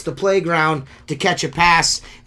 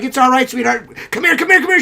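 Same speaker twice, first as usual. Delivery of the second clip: much higher, urgent coaxing voice; imitating concerned lady; 9 semitones higher, 5 dB louder, faster than usual. Lady soothing the injured window cleaner, high-voiced urgent coaxing. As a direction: imitate a customer trying to calm and catch someone. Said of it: "She's like".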